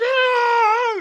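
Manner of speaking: long, high, loud outburst; imitated wild scream. Mimicking a guest who can't finish a sentence, wild scream. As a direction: scream it instead of answering.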